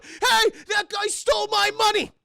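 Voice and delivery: high, loud cry for help; robbed manager shouting after the thief; alarmed shout. Retold or imitated. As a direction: yell it across the room.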